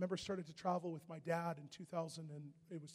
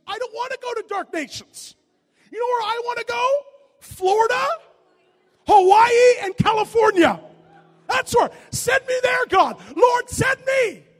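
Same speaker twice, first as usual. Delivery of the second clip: high, loud comic shouting; mock protest; 22 dB louder than usual. Reluctant young man bargaining with God, hammed-up shouted protest. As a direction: plead and refuse at full pitch, hammed-up.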